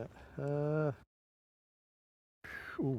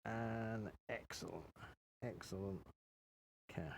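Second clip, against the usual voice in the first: slow, deliberate log entry; 3 semitones lower, 12 dB quieter, slower than usual. Retold or imitated. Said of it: "And I'm gonna say".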